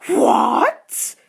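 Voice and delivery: loud, high, stunned cry; shocked outburst. A narrator's reading. Friends hearing of the proposal, shocked loud outburst. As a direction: blurt it out in shock.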